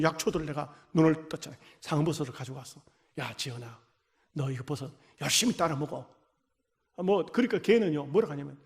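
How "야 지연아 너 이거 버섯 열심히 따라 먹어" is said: much lower and softer, coaxing; gentle coaxing. Retold or imitated. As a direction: urge her tenderly to eat it. Pastor coaxing sick girl. (암 걸린 지연에게 버섯을 먹으라고 다정하게 권하는 목소리)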